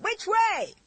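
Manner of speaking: high, slow shouted call over the noise; gleeful shout. A narrator's reading. William shouting to the driver while racing the carriage. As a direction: yell it happily from the driver's seat. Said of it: "he shouted".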